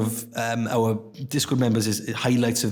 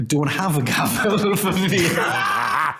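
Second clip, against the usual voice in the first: laughing; 6 semitones higher, 3 dB louder, much slower than usual. Real, not acted. Laughing mid-sentence.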